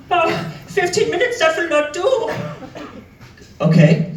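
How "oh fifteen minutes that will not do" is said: mock posh customer in a raised voice; flustered posh customer. Fussy customer mocks the wait, posh whine. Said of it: "he's a little flustered".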